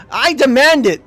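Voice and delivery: high, loud pompous demand; hammed-up imperious little captain; Captain Guppo. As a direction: demand it like a spoiled officer. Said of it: "ordering people around".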